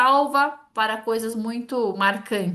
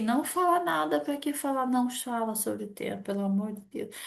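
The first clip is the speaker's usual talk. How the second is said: silent pleading; 6 dB quieter than usual. Retold or imitated. The cold person hoping the other stays quiet, soft pleading. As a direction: beg inwardly for silence.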